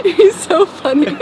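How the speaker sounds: words squeezed out through real laughter, slow; laughing. Real, not acted. Host cracking up at the guest's hotel joke, laughing. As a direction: laugh your way through the line.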